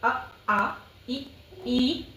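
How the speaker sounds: अ short, आ drawn out, ई short, ई stretched long; teacher contrasting अ आ and ई ई by length; short then stretched vowels. Shown as a demonstration. Teacher shows students short and long Hindi vowels. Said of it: "जो खींच के बोलते हैं".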